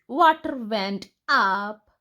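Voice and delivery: usual speaking voice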